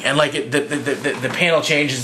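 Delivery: usual speaking voice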